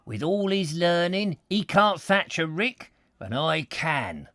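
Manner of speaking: proud rustic farmhand, slow; a countryman boasting with weight; emphatic old labourer. Acted. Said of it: "this with emphasis".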